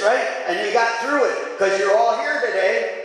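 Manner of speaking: high, ringing preacher's call to praise; full preaching. Acted. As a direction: lift the whole room up, preaching at full force. Rising preaching to congregation.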